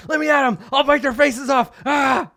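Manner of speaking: high, loud frenzied dog voice; barking attack dog. Acted. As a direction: yell it like a dog straining to attack. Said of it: "starts barking and jumps up on the thing".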